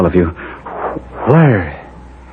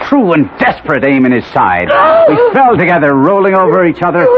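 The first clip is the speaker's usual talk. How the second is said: desperate climax; 8 semitones higher than usual. Acted. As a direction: throw yourself into the blow. Climax of the death struggle.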